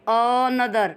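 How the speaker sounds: "another" drawn out in separated syllables; teacher demonstrating stress in "another"; syllable stress demo. Shown as a demonstration. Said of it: "दबाव दे के बोलते हैं".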